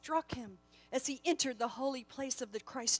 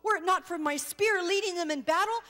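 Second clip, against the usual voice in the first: louder, higher and fast, hotly justifying himself; a stung commander snapping back at the angel; defensive anger; 6 semitones higher, 6 dB louder than usual. Acted.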